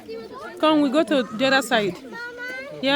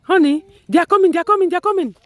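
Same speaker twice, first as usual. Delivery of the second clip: louder, eager announcing; excited calling out; 5 semitones higher, 5 dB louder, faster than usual. Real, not acted. Kids watching animals approach.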